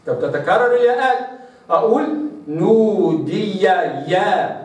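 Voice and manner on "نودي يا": نودي يا drawn out, ya clear; slow recitation. Shown as a demonstration. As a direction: stretch نودي يا. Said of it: "هكذا". (نودي يا ببطء)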